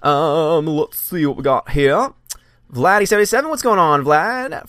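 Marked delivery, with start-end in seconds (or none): none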